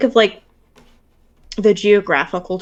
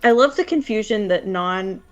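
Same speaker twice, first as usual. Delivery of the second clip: normal talking voice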